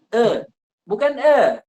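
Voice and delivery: "E" mocked in a Sundanese-style e, then a high, loud "bukan e". Shown as a demonstration. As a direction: imitate the Sundanese e, then reject it. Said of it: "Kayak orang Sunda". (e ala Sunda, lalu "bukan e" dengan tinggi)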